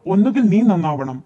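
loud angry outburst; very loud, wrathful scolding; furious ultimatum. A narrator's reading. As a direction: lay down a threat while beating. Aunt beating the girl, ultimatum.

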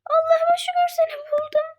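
high whispered relief; hushed joyful whisper; relieved whisper. Pretend. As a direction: breathe it out secretly. Finds friend secretly.

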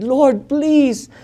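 loud, high pleading prayer; retold desperate plea; begging. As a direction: beg God urgently. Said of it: "And I begged God".

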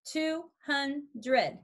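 "Two hundred" broken into two, hun, dred; three separate beats on "Two hundred"; syllables emphasized. Shown as a demonstration. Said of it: "now emphasize the syllables".

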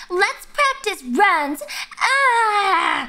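mock show-off singer. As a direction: parody someone suddenly practising vocal runs. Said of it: "suddenly I'm like".

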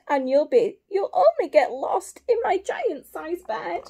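loud storybook wail; bawling. Acted. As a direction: keep up the mock bawling, loudly. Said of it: "hear the voice bawl".

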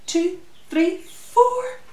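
ordinary delivery

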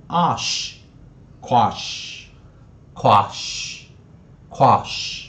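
"ash" as "ahsh", "quash" as "kwahsh"; slow, separate repeats of "quash" with an open back A; broken-sound "ash" drill. Shown as a demonstration. Teacher drills the variant vowel for students, slow "ahsh, kwahsh". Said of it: "首音破音念".

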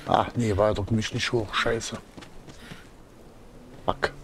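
normal talking voice

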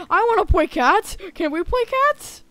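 put-on high, pleading eager child voice. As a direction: beg excitedly to play. Ragdoll begging to play catch.

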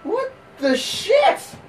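high, slow baffled cry; squeaked, incredulous reaction; startled disbelief. Real, not acted. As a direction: stretch it out in shocked disbelief. Startled during game.

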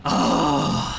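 long low groan, then breathless giggle; shaken groan and laugh. Real, not acted. Player recovering from a jump scare, groan into laughter. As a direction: let out the fright, then giggle.

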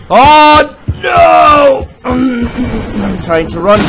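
high, loud desperate cry; panicked yelling. Real, not acted. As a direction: yell while fleeing in panic. Chased, trying to run.

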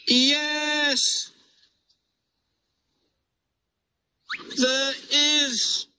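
very high, strained engine voice. Acted. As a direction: answer in a squeaky strained voice. Oliver answers his driver.

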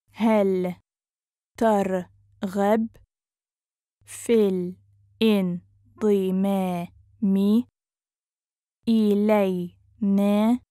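syllable by syllable. Shown as a demonstration. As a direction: sound it out with long gaps. Teacher breaks phrase into syllables.

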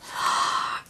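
quick intake of breath in surprise; delighted gasp. Real, not acted. Crafter spots cool buttons, delighted gasp. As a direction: gasp at a lovely find.

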